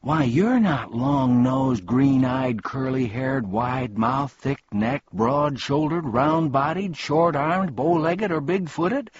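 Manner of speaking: high list that climbs in indignation; indignant. A narrator's reading. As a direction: tick off each feature, rising in protest. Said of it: "said Milo indignantly".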